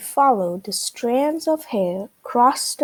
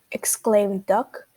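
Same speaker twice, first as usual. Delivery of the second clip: ordinary delivery, nothing marked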